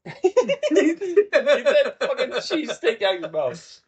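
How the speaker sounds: laughing. Real, not acted. Laughing at friend chewing.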